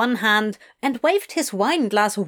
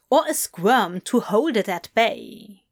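plain reading voice

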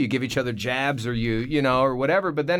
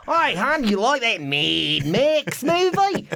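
much higher, slow, hammed-up Australian accent; bad Australian accent; 9 semitones higher, 4 dB louder, much slower than usual. Boyfriend teasing his Australian girlfriend, bad Australian accent. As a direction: tease in an absurdly broad fake Australian accent. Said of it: "it's not even an Australian accent".

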